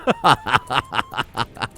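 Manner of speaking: long, helpless peal of laughter; exasperated laugh. Real, not acted. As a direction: break into a drawn-out frazzled laugh. Laughing after saying he'd be angry.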